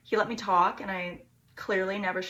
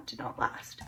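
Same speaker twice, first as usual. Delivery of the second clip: fading whisper; 10 semitones lower, 7 dB quieter than usual. Real, not acted. Trailing off at story end.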